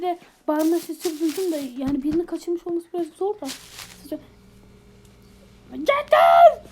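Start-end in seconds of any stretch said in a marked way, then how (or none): 5.7-6.6 angry shout. Acted. Neighbor bursts in angrily.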